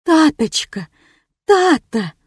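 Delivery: high cry of relief; anguished calling out. Acted. Mother rushes to bound aunt.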